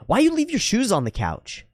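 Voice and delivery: high-pitched imitation of an accusing housemate; confrontational demand. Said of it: "And it didn't go well".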